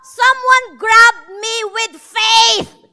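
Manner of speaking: slow, loud, high proclamation; Jesus declaring. Retold or imitated. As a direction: proclaim it slowly to the whole crowd. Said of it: "Sabi ni Jesus". (mabagal at malakas na pahayag ni Jesus)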